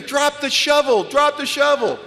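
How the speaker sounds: half-laughing mock panic, drawn out; inner panicked scream. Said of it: "my brain screaming".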